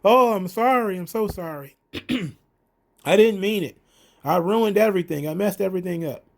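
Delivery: high, hammed-up fake sobbing apology; mock weeping as insincere Adam Cole. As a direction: whine the apology like a phony crier. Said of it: "Adam Cole then starts weeping".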